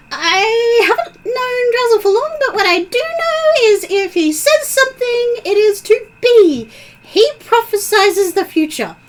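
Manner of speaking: high, sing-song fairy voice; pixie voice. Acted. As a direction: chirp it like a tiny pixie. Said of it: "the pixie voice appears next to your ear saying".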